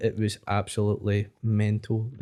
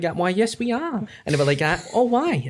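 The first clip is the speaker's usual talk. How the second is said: lightly voiced New York old ladies, higher; a hint of chirpy New York old dears; New York old lady; 7 semitones higher than usual. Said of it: "four wee old dears".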